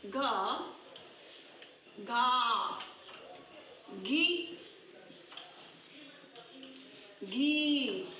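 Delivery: syllables spaced out one by one, as if writing on the board; teacher saying each syllable slowly while writing it; slow dictation. Shown as a demonstration. A teacher slowly dictates the barakhadi to her children.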